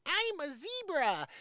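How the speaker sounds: high, slow mocking voice; mocking quote. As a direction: sneer it like a silly claim. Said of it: "get you to go along with things like".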